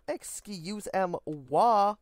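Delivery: mock French. French aside drawn out.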